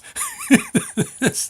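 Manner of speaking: a burst of high laughter. Real, not acted. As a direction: laugh out at the punchline. Laugh after punchline.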